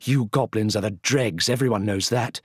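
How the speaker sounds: loud, high scornful queen; contemptuous high shout. Acted. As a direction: spit out the insult. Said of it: "she shouted".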